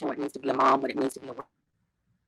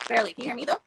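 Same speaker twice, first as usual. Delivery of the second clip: toy robot voice; 10 semitones higher, faster than usual. Real, not acted. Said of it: "You sound like a little robot".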